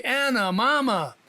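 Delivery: "Mama" hit hard on its first syllable, then falling; stress on MA of Mama. Shown as a demonstration. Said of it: "The emphasis is on the first syllable of Mama".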